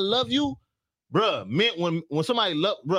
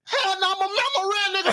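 shouted denial; 14 semitones higher than usual. Real, not acted. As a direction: holler it high in defiance. Indignant denial of crying when married.

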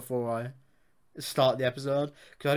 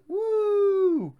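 long high-pitched "woo"; high whoop; 4 dB louder than usual. Pretend. Host cheering today's superhero topic, high drawn-out whoop.

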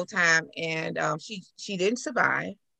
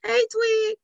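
high sing-out greeting; imitated high-pitched hail; neighbors calling out. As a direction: holler a nickname to a kid in passing.

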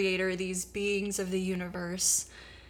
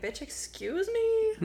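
high, slow, offended "Bitch, excuse me?"; sassy indignant retort; 13 semitones higher, slower than usual. Retold or imitated. Woman snapping back at Jesus, slow high sassy outrage. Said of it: "So she's like".

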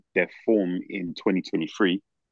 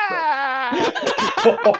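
laughing shout. Real, not acted.

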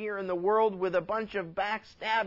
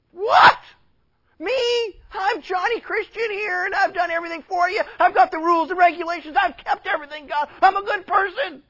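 much higher and louder, outraged; indignant churchgoer; 11 semitones higher, 9 dB louder than usual. Acted. Hypocrite refused entry, high outraged protest. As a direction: shriek your disbelief as the self-righteous one.

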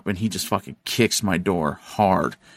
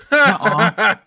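put-on high, loud childish nuh-uh; a petulant kid refusing to believe it; whiny denial; 13 semitones higher, 6 dB louder than usual. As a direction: dumb friend squeaking out a denial. Friend denying the car records.

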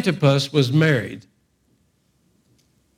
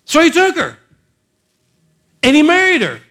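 high, loud revival preaching; fiery preacher climbing higher; preaching at full force; 12 semitones higher, 6 dB louder than usual. Acted. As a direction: whip the congregation up, loud and high.

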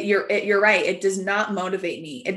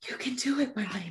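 self pep talk; 10 dB quieter, faster than usual. Retold or imitated. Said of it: "tapping my chest saying".

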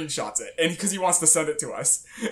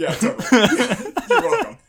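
laughing; 7 semitones higher, 7 dB louder than usual. Real, not acted. Joking back while laughing.

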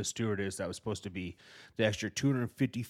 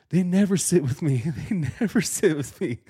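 put-on whiny scared kid; 9 semitones higher, 9 dB louder than usual. Voicing scared son at plane.

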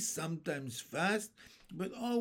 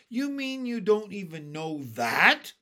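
loud, high, mocking question; centipede's loud incredulous taunt; incredulous cry; 6 semitones higher, 8 dB louder than usual. A narrator's reading. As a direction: jeer in disbelief, swooping up at the end. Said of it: "cried the centipede".

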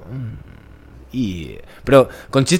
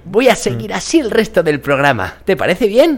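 terrible put-on voice; 8 semitones higher than usual. Mocking a dubbing student who talks like this. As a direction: overdo a cheesy announcer voice. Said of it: "lo estás haciendo muy bien".

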